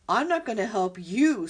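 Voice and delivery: loud screeching refusal. Acted. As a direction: screech it down from above. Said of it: "screeched the owl".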